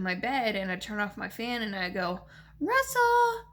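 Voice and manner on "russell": high, loud sing-song call to a dog. Retold or imitated. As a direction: call the dog like old times, much higher. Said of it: "Just like that. That's how I used to call him".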